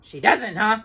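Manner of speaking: mild grumbled scoff; lightly grumbled dwarf scoff; grumbling dwarf. A narrator's reading. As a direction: grumble doubtfully. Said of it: "grumbled Grumpy".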